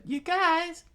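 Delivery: very high, loud falsetto cry; shocked little girl. A narrator's reading. Girl seeing her friends come to fight, shocked. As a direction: squeal it in shock. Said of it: "and Shock said".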